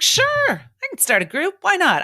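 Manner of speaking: replying to a client asking for a group, eager and high; eager yes. Retold or imitated. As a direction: toss it off like it's easy. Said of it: "I was like".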